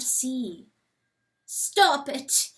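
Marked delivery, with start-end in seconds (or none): 1.4-2.5 higher, louder frightened plea; whimpering. A narrator's reading. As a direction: beg it to stop in terror. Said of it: "he whimpers".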